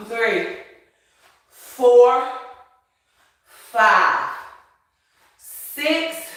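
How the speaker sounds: effortful counting reps, loud; counting reps while exercising. Real, not acted. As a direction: push through each count. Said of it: "Feel that burn".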